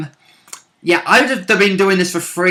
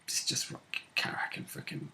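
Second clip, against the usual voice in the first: whisper; 5 semitones lower, 20 dB quieter than usual. Real, not acted. Player whispering mid-conversation.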